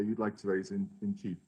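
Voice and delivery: normal talking voice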